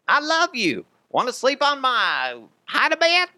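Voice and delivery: high, loud Cajun bellow; hammed-up raging Bayou boast; Brandon Stokley Cajun voice. Host doing his signature Stokley line in a loud, high Cajun voice. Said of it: "It's a horrible Stokley impression".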